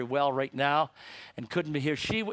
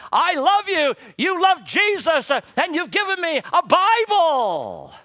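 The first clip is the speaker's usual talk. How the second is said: put-on overjoyed child's thanks, rising to a cry; excited little girl; 13 semitones higher, 9 dB louder than usual. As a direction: start hushed and burst into delighted gratitude. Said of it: "She was so excited".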